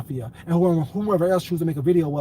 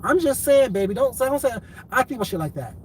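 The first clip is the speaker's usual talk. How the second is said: ordinary talk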